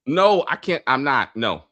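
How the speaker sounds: ordinary delivery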